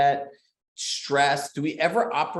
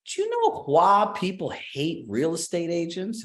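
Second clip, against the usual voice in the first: slow Southern drawl on a gently raised question; Southern accent; 5 semitones higher, slower than usual. Retold or imitated. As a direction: imitate the Southern speaker, a touch higher and slower. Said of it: "in her Southern accent".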